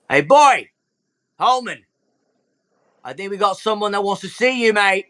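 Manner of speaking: Foxface, high and hearty hail; Foxface calling out. Acted. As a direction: call him over like an old mate.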